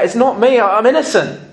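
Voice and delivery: high, loud acted protest; imitating a desperate condemned man; kicking and screaming. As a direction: cry out your innocence, high and pushed. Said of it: "kicking and screaming".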